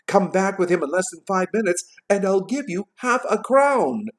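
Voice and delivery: high, bargaining excitement; eager Scrooge. A narrator's reading. Scrooge promising the boy money, eagerly. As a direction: raise the pitch for the bigger offer.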